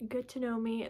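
ordinary talk, nothing marked

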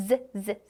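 "Z, Z" as short buzzing z sounds, not letter names; isolated "Z" sound for learners; voiced z sound. Shown as a demonstration. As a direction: demonstrate "Z" as a brief voiced buzz. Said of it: "우리 Z 발음을 내줄게요".

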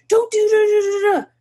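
mock scolding parent. Parent towering over child, mock scolding nag. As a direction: loom over and nag in a hammed-up voice. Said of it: "you're telling them".

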